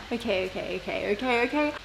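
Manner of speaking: soft, rapid okays under breath; quiet. Real, not acted. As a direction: murmur okay to yourself.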